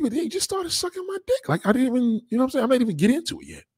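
hammed-up flustered complaint; mock exasperated protest. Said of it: "I said".

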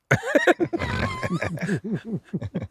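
long, squealing high laugh; high-pitched laugh. Real, not acted. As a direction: break into a very high wheezy laugh. Laughing at his own joke.

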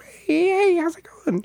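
high squeaky little mouse voice. Shown as a demonstration. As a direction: squeak it like a tiny cartoon mouse. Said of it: "a mouse, uh, voice sound effect".

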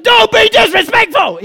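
high, loud parental outburst; angry shout. Retold or imitated. Dad to kid who answers back, angry shout. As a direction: explode at the backchat.

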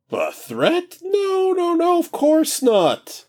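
high, breezy, carefree god; casual new voice. Acted. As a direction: stretch and chat like just woken. King denies being a threat.

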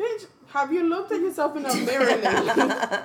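loud, high, laughing roast of herself; laughing mock scold. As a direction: cackle through a teasing put-down, slowing at the end. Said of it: "be like".

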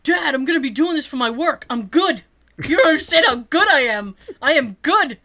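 insistent imitation of a gamer kid; protesting son. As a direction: plead your case to dad, punching the words. Son protesting to his dad.

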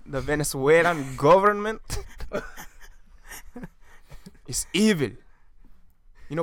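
put-on light Venezuelan accent, rolling the names; a hint of a Venezuelan Uber driver; mild Venezuelan accent ranting about the government. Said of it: "you're really good at that".

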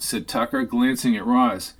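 normal talking voice

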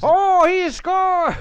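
high, slow, shouted goal call; frantic mock sports commentary. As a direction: belt it like a commentator calling a goal. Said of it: "do play-by-play while we do the podcast".

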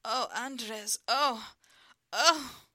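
loud, high ecstatic cries; climax cries. Acted. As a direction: cry out at climax. Lover crying out at climax.

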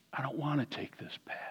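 breathy hushed whisper. Retold or imitated. A troubled believer confessing doubt, in a whisper. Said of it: "you may be tempted to say".

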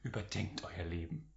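deep, soft, solemn stage voice; theatralische Stimme. Pretend. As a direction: intone it like a hammy stage actor. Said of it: "Du hast die theatralischste Stimme von uns allen". (tief und feierlich, wie ein theatralischer Bühnenschauspieler)

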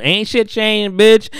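a high gloating "Ain't shit changed" that ends on a loud "bitch"; mock taunt. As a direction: rub it in like a gloating robber. Gleeful robber taunting Issa.

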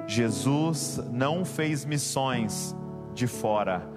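ordinary talk

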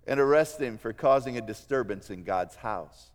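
ordinary talk

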